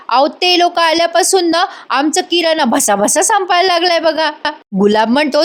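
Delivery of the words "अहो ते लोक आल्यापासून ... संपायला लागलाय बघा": higher, whiny village wife complaint; complaining village wife. Acted. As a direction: grumble about the groceries running out. Wife complains about relatives.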